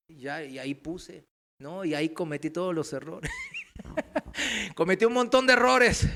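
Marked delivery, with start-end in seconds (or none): 3.4-4.7 high laugh at himself; rueful laugh. Real, not acted. Preacher recalls his own mistakes, real self-mocking laugh. 4.7-6.1 louder, higher, just after laughing; amused confession. Real, not acted. Preacher admits his past errors to the church, amused exclamation.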